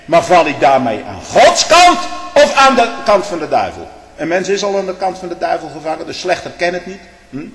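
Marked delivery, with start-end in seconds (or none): 0.1-3.9 loud pulpit challenge; a loud preacher's question; raised preaching question. Acted. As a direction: fling the question at the pews.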